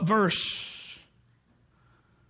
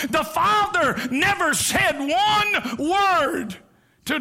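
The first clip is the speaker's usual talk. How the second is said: fervent preaching; 4 semitones higher than usual. Acted. Preaching the father's love.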